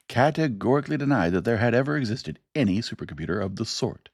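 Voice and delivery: announcer reading a news story on air. A narrator's reading. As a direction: read it like a broadcast bulletin. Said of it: "he began".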